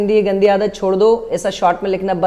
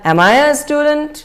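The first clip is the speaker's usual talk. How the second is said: higher, slow, student pitched up; rising tone; 5 semitones higher, much slower than usual. Shown as a demonstration. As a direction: lift the end gently. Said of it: "rising tone में बोलना है". (ऊँची, धीमी rising tone)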